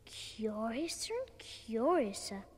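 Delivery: slow whispered wonder. Acted. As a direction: breathe it in slow amazement. Alice marvelling to herself.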